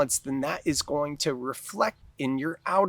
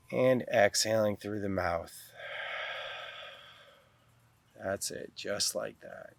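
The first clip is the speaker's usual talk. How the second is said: guided meditation. Real, not acted. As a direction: guide them calmly into the breath, unhurried.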